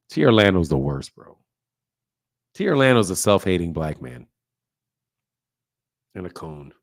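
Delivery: low, drawn-down mocking voice; hammed-up low-pitched grumbling detractor; mock critic. Mockingly voicing his critics' insults.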